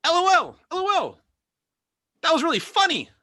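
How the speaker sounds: high, loud mocking read of LOL; chat message voice. Comic mocks chat typing LOL in high loud voice. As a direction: spell it out in a silly high voice. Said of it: "Probably my favorite".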